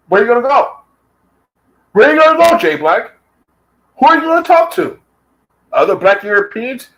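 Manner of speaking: jeering taunt. Real, not acted. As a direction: crow at him, voice up high. Taunting rival with rhetorical questions.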